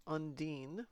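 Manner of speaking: ordinary talk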